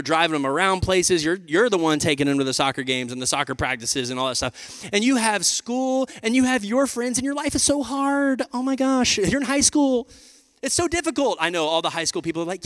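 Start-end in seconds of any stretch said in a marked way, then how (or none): 4.9-11.4 mock teen whining. Mocking whiny high schoolers.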